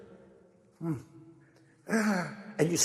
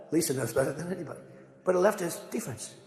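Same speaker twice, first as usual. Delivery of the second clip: ordinary delivery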